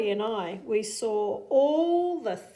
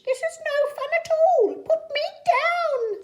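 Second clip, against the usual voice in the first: fish character voice; 7 dB louder than usual. Acted. Fish held up high begs the cat, high and loud. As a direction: wail it from up in the air. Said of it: "said the fish".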